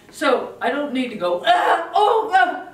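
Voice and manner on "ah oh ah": loud, high groaning yell of frustration; the parent you should not be, hammed up; mock exasperated yell. Shown as a demonstration. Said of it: "like this when she whines".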